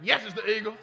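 very loud imitated indignant cry; mock fan outburst. Band fans in the congregation objecting, loud mock outburst. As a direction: blurt it out loudly like an offended fan.

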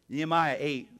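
ordinary talk, nothing marked

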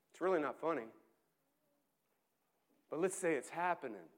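ordinary talk

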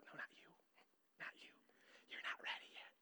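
hushed whisper, telling her not yet. Retold or imitated. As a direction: whisper it so she won't hear. Father hushing eager daughter.

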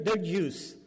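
ordinary talk, nothing marked

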